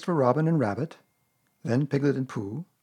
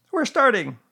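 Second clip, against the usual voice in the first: high, loud animal character voice; brisk storybook busybody, pitched right up; Rabbit; 11 semitones higher, 6 dB louder than usual. A narrator's reading. Rabbit answering Pooh's greeting, high character voice.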